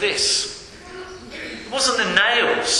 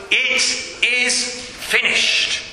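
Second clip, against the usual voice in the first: a slow, higher proclamation; mildly raised cry; 5 semitones higher, much slower than usual. Acted. Preacher quoting Christ's last cry, measured proclamation. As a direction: proclaim it slowly, drawing out each word. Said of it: "what he cried in that loud voice".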